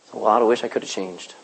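low soft sigh of regret; a touch of a sorry dad; regretful sigh. Retold or imitated. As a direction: breathe out the old regret. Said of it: "look back on his life and go".

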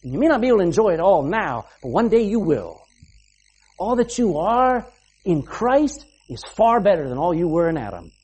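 usual speaking voice

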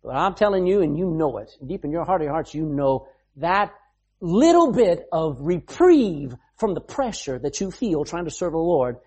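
normal talking voice

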